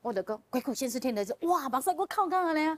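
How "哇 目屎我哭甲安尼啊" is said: high, loud cry of amazement; astonished exclamation. Retold or imitated. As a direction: burst out in disbelief, pitch leaping up. Astonished at sudden crying again.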